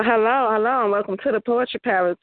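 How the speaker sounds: ordinary talk, nothing marked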